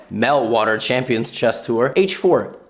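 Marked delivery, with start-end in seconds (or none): none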